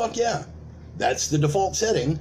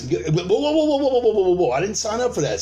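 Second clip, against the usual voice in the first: mock alarmed whoa; 5 semitones higher than usual. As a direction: throw up your hands, alarmed. Acted protester halting.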